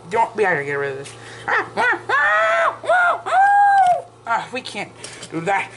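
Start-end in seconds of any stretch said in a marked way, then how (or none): 1.5-4.1 very high yelps, building louder; repeated mock screams. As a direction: squeal in rising play panic. Toy figures panic.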